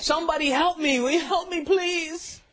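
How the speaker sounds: high-pitched, whimpering comic begging; pretend-tearful cry for help; mock desperate plea. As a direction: beg for rescue in a hammed-up wail. Comic skit, begging to be rescued.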